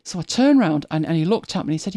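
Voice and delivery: usual speaking voice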